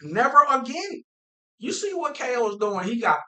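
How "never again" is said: mock vow. Quoting both quarterbacks' vow.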